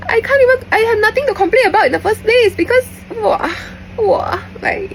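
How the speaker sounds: high, loud gushing squeal; giddy excited. Real, not acted. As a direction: gush about him like a giddy fan. Giddy high squealing over his looks.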